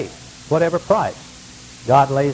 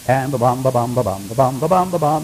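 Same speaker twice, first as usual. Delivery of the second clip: low walking rhythm; 5 semitones lower than usual. Retold or imitated. Imitating footsteps on a journey.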